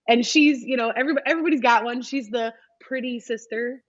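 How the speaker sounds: ordinary talk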